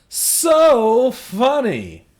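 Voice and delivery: loud, high, drawn-out sarcasm; heavily sarcastic, slow and loud. Real, not acted. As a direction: stretch it out in mock amusement. Sarcasm about the hammered joke.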